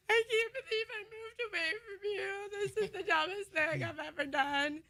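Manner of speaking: high, whiny mock crying; tearful wail. Daughter calling dad after moving away, imitated tearful wail. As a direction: replay a homesick wail, high-pitched and weepy.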